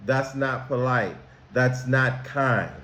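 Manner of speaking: usual speaking voice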